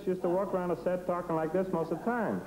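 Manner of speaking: light imitation of a little photographer's voice; Ouija's little voice. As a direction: pitch it up like the tiny fellow on set. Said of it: "a little voice like this".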